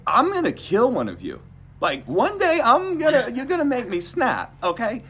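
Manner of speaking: loud, high-pitched exasperated outburst; exasperated threat. Retold or imitated. Patient to ER staff, exasperated threat. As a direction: act out a patient at breaking point.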